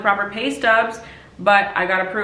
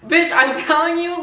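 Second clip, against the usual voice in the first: excited exclaim; 9 semitones higher than usual. Real, not acted. Excited payoff of the story.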